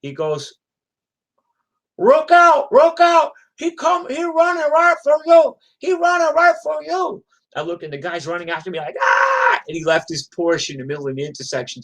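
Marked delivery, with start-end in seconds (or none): 2.0-7.2 very high, shouted warning in an imitated Chinese accent. Deliveryman warning cyclist of pursuer, shouted Chinese accent. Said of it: "I'm just depicting his Chinese accent". 9.0-9.6 very high, loud mock scream; imitated charging yell. Enraged driver running after cyclist, imitated yell.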